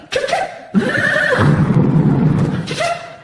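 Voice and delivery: imitated chirps and engine cranking; high mouth-made beeps, then a sputtering start; vocal sound effect of a car alarm; car remote start noise.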